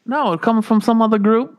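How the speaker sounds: put-on terrible Australian accent. Host teasing listeners in Australia: bad Australian accent. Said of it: "my terrible Australian accent".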